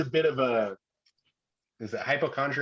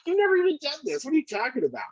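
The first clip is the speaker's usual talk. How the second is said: jumps way higher in mock disbelief; incredulous retort; 13 semitones higher, faster than usual. Dad to bragging son, high incredulous retort. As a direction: pitch it up high like you can't believe it. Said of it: "It's like".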